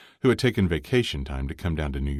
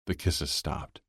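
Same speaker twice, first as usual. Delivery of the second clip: ordinary delivery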